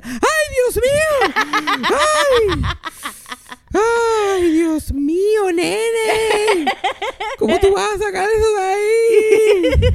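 high, loud, panicked mom voice; shrieking mother. Retold or imitated. Mother shrieking in horror over the snake video. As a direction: wail like a horrified mother.

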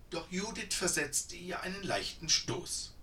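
ordinary delivery

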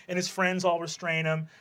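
ordinary talk

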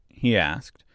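ordinary delivery

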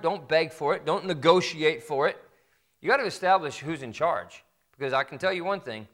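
normal talking voice

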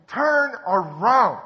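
loud slow high shout; yelling. Retold or imitated. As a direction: call it out over the crowd. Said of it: "just yelling".

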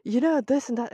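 mocking the teacher. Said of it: "And the teacher is like".

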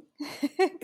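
a quick high laugh at herself; real giggle; amused laugh. Real, not acted. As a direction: laugh it off lightly. Laughs at own English.

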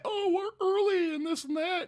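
high mock-complaining voice; mock complainer. Streamer mocking talk of being early, in a high put-on voice.

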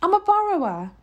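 high-voiced pleading declaration; upset cry. A narrator's reading. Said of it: "cried the boy, his lips trembling".

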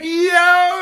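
high, drawn-out mock yell; excited shout. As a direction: yell out an amazed, stretched "yo".

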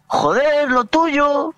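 high-pitched, impatient mimicked driver; insistent stranger. Retold driver explains what he means, high insistent call. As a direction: imitate a driver calling out, insistent.

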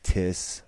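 Tiss with a hard t, no th sound; imitating Scottish. Imitating a Scottish guest, Tiss for this. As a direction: drop the h, say Tiss. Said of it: "He talks like Tiss".

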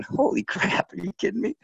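high-pitched retold amazement; acted astonished thought; disbelief. Retold amazed reaction to coach.

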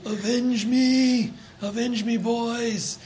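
high, slow, hammy dying plea; overacted death scene. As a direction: ham up a dying father's last cry. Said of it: "Can you picture yourself doing that".

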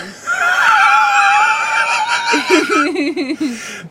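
long, very high held squeal breaking into giggles; high squeal into laughter. Real, not acted. Co-host teased about watching herself, squealing with laughter.

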